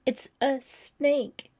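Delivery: barely held panic. A narrator's reading. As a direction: fight to keep the voice steady. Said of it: "trying not to sound hysterical".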